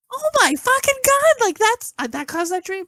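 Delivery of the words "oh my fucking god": high-pitched exclamation of disbelief; retold shock. Woman reacting to her nightmare about her ex, shocked.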